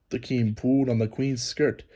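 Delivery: ordinary delivery, nothing marked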